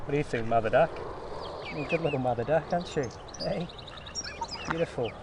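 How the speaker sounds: sweet-talking a mother duck with seed; cooing to a duck. Real, not acted. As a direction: coax the duck like a pet.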